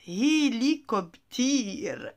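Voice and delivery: "hélicoptère" drawn out very slowly; "hélicoptère" said slowly and whole; slow full word. Shown as a demonstration. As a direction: stretch "hélicoptère" for learners. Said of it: "Amusez-vous à le prononcer".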